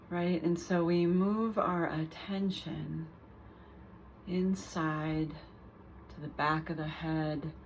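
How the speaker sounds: calm guided meditation with long pauses between phrases; guided body scan. Real, not acted. Teacher guiding listeners through a mindful body scan, unhurried meditation voice. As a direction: lead them gently through the body, leaving space after each phrase.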